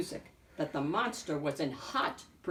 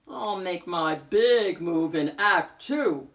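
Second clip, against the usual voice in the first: slow, louder sly wolf voice; scheming wolf; 7 dB louder, slower than usual. A narrator's reading. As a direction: savour the plan like a plotting wolf. Wolf plotting his move.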